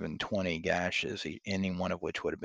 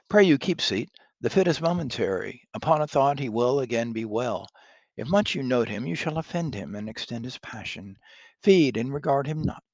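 louder Lady Macbeth, covering for him; hammy stage actor; 5 semitones higher, 7 dB louder than usual. Acted. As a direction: play it big for the guests. Reads Lady Macbeth's lines aloud.

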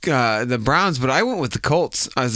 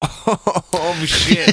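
laughing; 6 semitones higher than usual. Real, not acted. Reacting to dumpy team jab.

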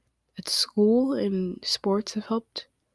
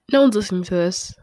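blurted louder and faster, half laughing; louder, quicker throwaway; 7 dB louder, faster than usual. Real, not acted. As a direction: toss it out loud and quick. Repeats line, second time louder.

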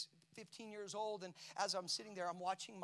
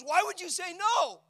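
shouted question; 11 semitones higher, 15 dB louder than usual. Retold or imitated. Wife asks why he refused.

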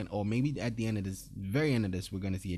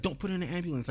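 higher-pitched urgent plea, retold; 8 semitones higher, much faster than usual. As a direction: mimic someone rushing up to stop them. Retells Tandy running up urgently.

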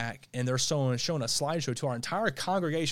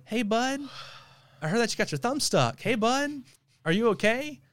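high, mock-sweet sarcastic voice; 6 semitones higher than usual. Youth pastor teasing a stuck kid, sarcastic and high. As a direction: sing it out like a smug grin. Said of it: "this sarcastic, you know, voice to you".

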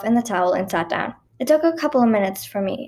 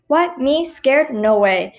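fake bravado; 5 semitones higher, 5 dB louder, much slower than usual. A narrator's reading. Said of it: "obviously lying".

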